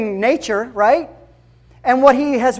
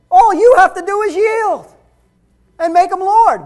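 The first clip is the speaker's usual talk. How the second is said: raised, high-pitched pulpit proclamation; impassioned preaching; 7 semitones higher, 5 dB louder than usual. Real, not acted. Preacher proclaiming Christ took your place, impassioned preaching. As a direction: drive it home loud and high to the congregation.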